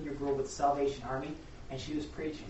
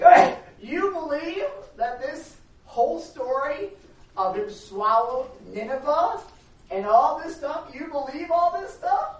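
high, loud, slow taunt; hammed-up incredulous mockery, stretching each phrase; mocking lawyer; 11 semitones higher, 10 dB louder, slower than usual. Agnostic lawyer to little girl, loudly mocking. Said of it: "he continues to mock her".

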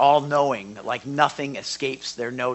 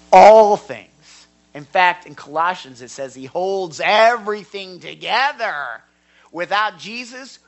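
much louder, higher preaching; loud, high emphatic preaching; preaching at full force; 7 semitones higher, 13 dB louder than usual. Real, not acted. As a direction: proclaim it from the pulpit. Preaching climax.

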